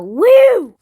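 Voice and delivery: loud high whoop; loud excited cowboy whoop. Pretend. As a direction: whoop like a rodeo rider. Cowboy whoop in Wild West game.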